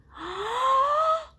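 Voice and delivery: big audible inhale; deep breath in. Shown as a demonstration. Teacher demonstrating a big breath for children, audible inhale. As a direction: draw a loud breath in through the mouth. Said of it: "You take a big breath in with me".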